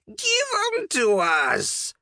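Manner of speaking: very high, drawn-out creature shriek; goblin shriek. A narrator's reading. Goblin on the knight's head demands his eyeballs, shrieking high. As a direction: shriek it slowly, like a greedy little goblin. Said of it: "it shrieked".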